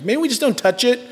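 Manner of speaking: high-pitched hopeful suggestion; mock wishful suggestion. Wishful parent voiced in story.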